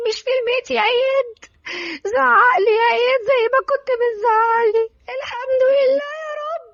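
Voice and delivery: high, tearful wail; grief-stricken crying. Acted. Darin cannot leave Iyad's grave, crying in grief. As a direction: sob through the words in a high voice.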